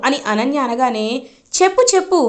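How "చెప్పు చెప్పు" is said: mocking dare. Mother-in-law taunts Ananya.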